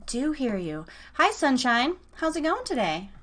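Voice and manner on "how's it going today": ordinary talk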